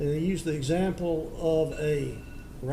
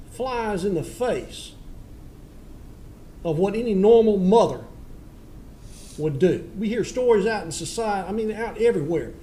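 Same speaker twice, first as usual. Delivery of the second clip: stern scolding; 3 semitones higher, 5 dB louder than usual. Real, not acted. Judge scolds defendant at sentencing.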